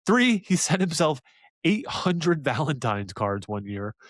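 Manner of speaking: usual speaking voice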